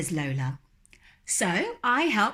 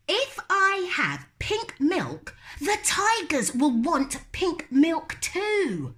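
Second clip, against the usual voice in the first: put-on little girl refusing; 11 semitones higher than usual. Lola refusing to go to bed, high and stubborn. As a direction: dig in like a stubborn small child.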